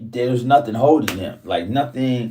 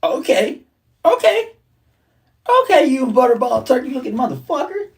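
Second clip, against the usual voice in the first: sarcastic okay; 9 semitones higher than usual. Retold or imitated. Mock insult to a hater.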